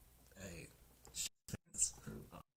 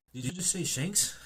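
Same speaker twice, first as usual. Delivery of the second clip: higher, louder startled question; mock-startled, pitched up; surprised; 8 semitones higher, 5 dB louder than usual. Luffy interrupting on hearing Shanks's name, surprised.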